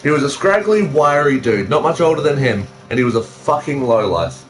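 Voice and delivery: usual speaking voice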